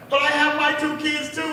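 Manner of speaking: high, loud tearful protest; mock crying. Sister's friend pleads as police take her, mock crying. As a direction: imitate a friend wailing in protest. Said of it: "my sister's friend starts crying".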